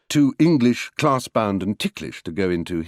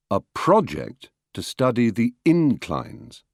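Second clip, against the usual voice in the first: "project" and "inclines" pushed on first syllables; noun stress; slower than usual. Shown as a demonstration. Lecturer showing stress by meaning, nouns "project" and "inclines" stressed at the start. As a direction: say PRO-ject and IN-clines, unhurried. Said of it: "stress will change according to the meaning".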